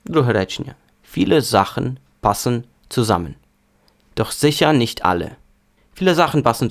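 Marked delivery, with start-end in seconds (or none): 1.1-5.3 повільно. Shown as a demonstration. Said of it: "спочатку повільно, потім швидко". (повільне навчальне читання німецькою)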